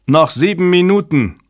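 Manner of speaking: high, urgent countdown call; shouting. A narrator's reading. Technician warning the crisis team of time left, shouting. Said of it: "schrie ein Techniker".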